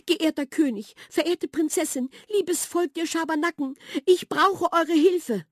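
high, small mouse voice, slowing at the end; a nervous mouse pleading before the king; high trembling mouse begging for help. A narrator's reading. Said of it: "Millers Stimme bebte".

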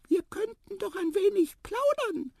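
very high, thin squeak; small mouse voice. A narrator's reading. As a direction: pipe it close to someone's ear. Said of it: "wisperte es jetzt ganz dicht". (hohes Mäusestimmchen)

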